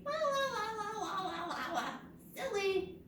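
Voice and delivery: high, goofy la-la babble; silly high-pitched nonsense. Pretend. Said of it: "one named Silly".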